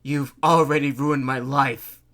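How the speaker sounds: loud, anguished begging; begging sob. A narrator's reading. Mayor sobbing his plea to the Cat Queen. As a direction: beg through tears. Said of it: "he begged, sobbing".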